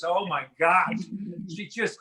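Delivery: normal talking voice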